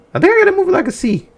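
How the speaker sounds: high, fast put-on voice; squeaky goofy voice giving a grade; silly voice. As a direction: chirp the grade in a goofy character. Silly voice rating.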